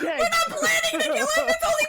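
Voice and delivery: laughing shout. Real, not acted. Players laughing at deception roll.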